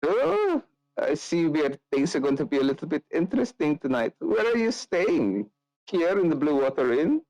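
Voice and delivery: fish for where she's staying; flirty Nikolai. Acted.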